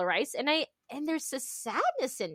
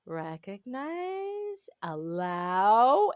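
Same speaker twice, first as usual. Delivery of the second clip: slow mantra; 3 semitones lower, much slower than usual. Retold or imitated. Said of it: "that I need to just".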